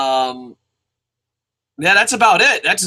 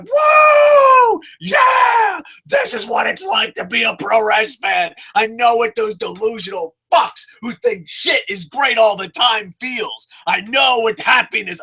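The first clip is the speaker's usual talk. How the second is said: joyous whoop; 5 semitones higher than usual. Retold or imitated. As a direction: cheer like a delirious fan. Quoting own joyous reaction.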